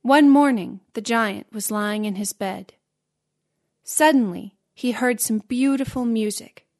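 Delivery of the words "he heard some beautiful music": ordinary delivery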